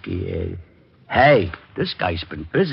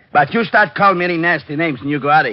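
raised, higher threat; heated threat; 7 semitones higher, 4 dB louder, faster than usual. Acted. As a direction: push back hard at the insult. Sergeant threatens to throw him out.

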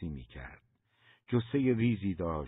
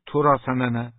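loud, high snap with a Turkish ring on سننه; sharp Turkish retort; 5 semitones higher, 10 dB louder than usual. A narrator's reading. Mother snapping at her son in a loud Turkish retort. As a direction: fling سننه back like a native Turkish speaker. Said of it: "جوری گفت سننه که".

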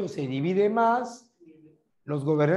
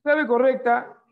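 game show reveal; 6 semitones higher, 6 dB louder than usual. Acted. Announcing the quiz answer.

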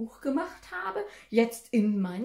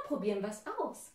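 usual speaking voice

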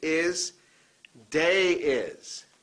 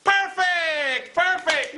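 much higher, louder "Perfect!"; delighted praise; 10 semitones higher, 3 dB louder, much slower than usual. Real, not acted. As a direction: beam at the class. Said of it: "teacher praising students".

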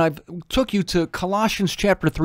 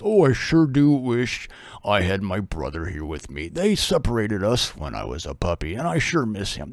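low, softer dog voice missing his brother; wistful dog; 9 semitones lower, 5 dB quieter than usual. Retold or imitated. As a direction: sigh it like a lonely dog, low and soft. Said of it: "mourn for the past".

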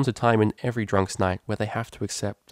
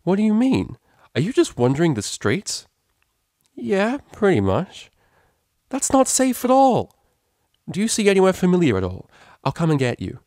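high, louder worried questioning; alarmed cellist; 10 semitones higher, 5 dB louder than usual. A narrator's reading. Cellist hearing her friend wanders the streets, alarmed. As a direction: fuss in alarm and take charge.

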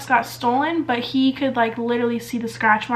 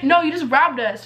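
normal talking voice

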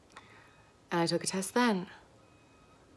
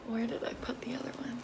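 soft whisper; whispering to herself; 4 semitones higher, 10 dB quieter than usual. Real, not acted. Looking for the second test, whispering to herself. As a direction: mutter it in a whisper.

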